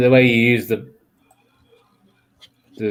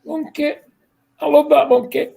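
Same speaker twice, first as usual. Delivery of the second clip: high puppet monkey voice; 12 semitones higher than usual. Retold or imitated. Friend imitates the ad monkey, high and playful. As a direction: pipe up like the TV monkey. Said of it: "when he did the monkey".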